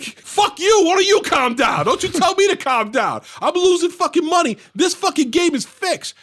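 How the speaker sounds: high-pitched, furious retelling of a father; hammed-up rant back at security; yelling and screaming. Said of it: "like yelling and screaming".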